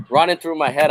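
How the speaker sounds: ordinary talk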